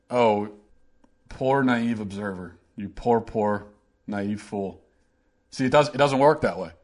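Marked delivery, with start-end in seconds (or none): 0.1-4.8 slow, soft, low condescending sympathy; mock pity. Host pitying the naive observer, mock sympathy. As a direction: pity them with drawn-out, quiet words.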